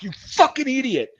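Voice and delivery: high-pitched mock fury; retold angry outburst. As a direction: re-enact cursing out your driver. Gunner cursing driver while kicking him.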